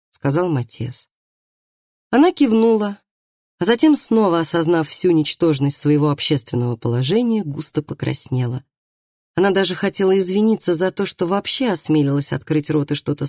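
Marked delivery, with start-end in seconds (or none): none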